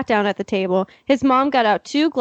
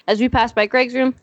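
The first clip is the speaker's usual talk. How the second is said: ordinary talk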